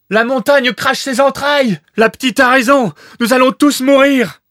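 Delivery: terrified bather crying out as the volcano erupts; panicked cry. Acted. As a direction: scream it as the mountain explodes.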